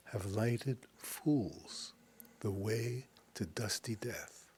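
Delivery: slow, measured Shakespeare recital with long pauses; poem recital. Acted. Teacher reciting Macbeth from memory, slow and solemn.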